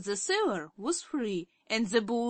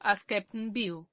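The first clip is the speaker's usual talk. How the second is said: ordinary delivery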